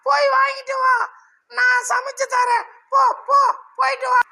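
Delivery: husband's wife sending him off, high falsetto shout; shrill wife voice. Acted.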